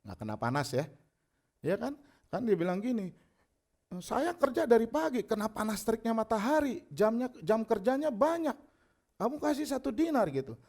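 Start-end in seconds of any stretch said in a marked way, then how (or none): 4.0-10.3 high-pitched worker's complaint; acted protest. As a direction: plead your case as the wronged worker. First worker protests his pay.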